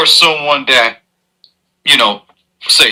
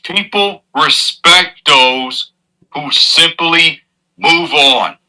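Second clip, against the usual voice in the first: slow emphatic; much slower than usual. Real, not acted.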